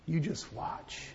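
soft, unvoiced promise; quiet confident whisper. Jesus quietly answering the scoffers, whispered. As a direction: whisper it with certainty.

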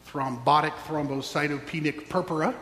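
ordinary talk

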